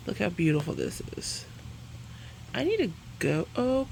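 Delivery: ordinary talk, nothing marked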